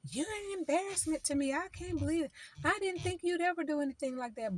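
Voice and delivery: high, exasperated parent voice; a parent's high, disappointed scold; mock scolding parent.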